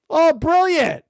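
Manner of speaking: ordinary talk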